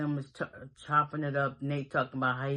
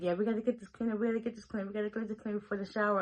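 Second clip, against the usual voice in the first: raised, fast mock voice of a fussy woman; playfully imitating her urgency, pitched up; 6 semitones higher, much faster than usual. Mother fussing over cleanup.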